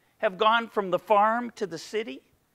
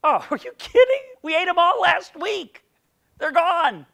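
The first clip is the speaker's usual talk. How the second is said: very high, loud, laughing scoff; mock the customer like a cheeky waiter; 10 semitones higher, 6 dB louder than usual. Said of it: "going into a restaurant and ordering chicken wings".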